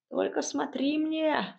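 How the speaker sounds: high, drawn-out witch's warning; stern warning. A narrator's reading. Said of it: "строго добавила Яга". (строгий голос Яги)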